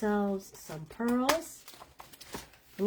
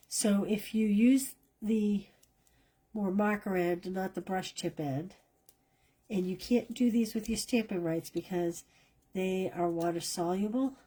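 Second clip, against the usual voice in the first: normal talking voice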